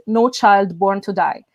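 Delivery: normal talking voice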